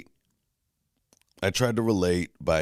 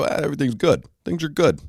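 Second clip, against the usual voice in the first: mock breezy "everything's good", much higher; fake cheer; 10 semitones higher, 3 dB louder than usual. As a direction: brush it off with bright fake cheer. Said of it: "why didn't I just go".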